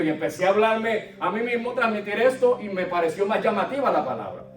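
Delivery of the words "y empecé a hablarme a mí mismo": ordinary talk, nothing marked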